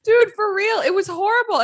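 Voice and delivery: high, loud and worked up; excited outburst. Real, not acted. Friend exclaims to friend about the book, high and loud. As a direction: burst out, appalled by the scene. Friend reacting to a sad scene.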